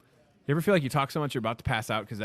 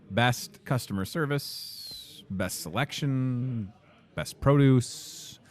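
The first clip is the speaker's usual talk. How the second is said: slow ad read; much slower than usual. Acted.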